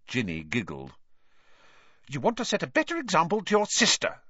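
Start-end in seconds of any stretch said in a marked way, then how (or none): 2.1-4.2 fast, raised sharp retort; snapping. A narrator's reading. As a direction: snap it at him quickly. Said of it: "snapped Missus Weasley".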